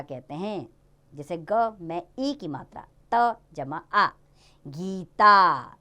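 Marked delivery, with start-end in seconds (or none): 4.7-5.8 both syllables stretched very slowly; drawn-out word. Shown as a demonstration. Teacher joins letters into a word for children, drawn out.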